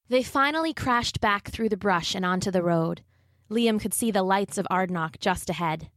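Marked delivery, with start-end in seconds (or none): none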